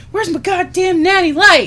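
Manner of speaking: high, loud mock fury; hammed-up angry yell; angrier outburst. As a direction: blow up demanding your beer. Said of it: "angrier and angrier with every drink".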